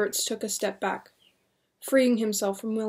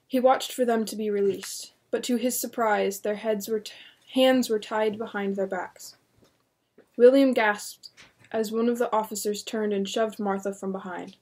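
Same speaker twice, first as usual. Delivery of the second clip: ordinary delivery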